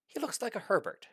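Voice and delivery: a hint of an amused doctor; tossed-off remark about the dragon; lightly voiced doctor. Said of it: "she commented to one of her assistants".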